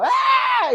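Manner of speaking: loud, high retold yell; hard yell. Husband repeating himself to someone who didn't hear, exasperated high yell. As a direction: yell it out as if not heard. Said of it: "se lo digo bien duro".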